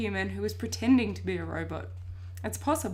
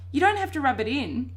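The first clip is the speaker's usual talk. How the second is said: ordinary delivery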